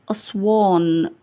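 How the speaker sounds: slow, lengthened last vowel; drawn-out dictation. Shown as a demonstration. Teacher listing words like Aswan, drawn out. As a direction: let the word stretch out.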